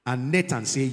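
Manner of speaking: ordinary talk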